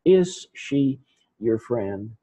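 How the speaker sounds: "Is she your friend?" slowly, word by word; slow model reading. Shown as a demonstration. Teacher models question word by word.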